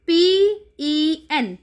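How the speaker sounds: letters spelled out one by one, slowly; slow spelling. Shown as a demonstration. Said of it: "spelling letters for child".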